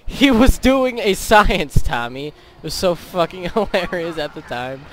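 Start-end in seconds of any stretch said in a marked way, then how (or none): 0.1-2.3 retold scream. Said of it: "and I screamed".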